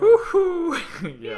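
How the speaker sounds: loud high whoop; excited cheer. Real, not acted. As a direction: whoop with glee. Cheering at the game.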